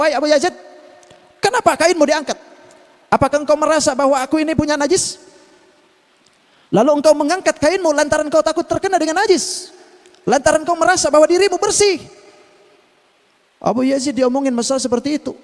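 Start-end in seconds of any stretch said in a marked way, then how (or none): 0.0-12.0 higher, pressing talking dog voice; a dog scolding the proud sheikh, voice raised; talking dog rebuking. Acted. Dog confronts Abu Yazid about feeling clean, higher and pressing. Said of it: "anjing balik ngomong".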